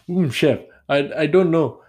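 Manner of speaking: put-on shaky-footed stab at an Indian accent; attempted Indian accent. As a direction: try an Indian chef and miss. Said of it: "Is that, is that Indian?".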